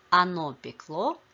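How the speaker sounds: пекло with the last syllable stressed, пекЛО; stress on ending. Shown as a demonstration. Teacher models the neuter past of печь, stress on ending. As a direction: hit the final ЛО in пекло. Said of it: "the stress shifts to the ending".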